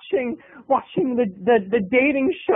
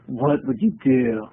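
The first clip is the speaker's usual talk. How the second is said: low, slow spacey delivery; deadpan dude voice; 10 semitones lower, slower than usual. Shown as a demonstration. Comic redoes the question in a spaced-out voice. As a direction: say it like a stoned surfer. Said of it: "That's very Keanu Reeves".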